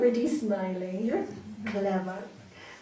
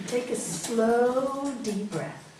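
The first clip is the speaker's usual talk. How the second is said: slow breath cue; much slower than usual. Shown as a demonstration. Teacher showing the child the breath, drawn out slowly. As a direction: stretch out slow like leading a breath. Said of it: "everybody can do it with us".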